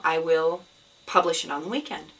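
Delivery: ordinary delivery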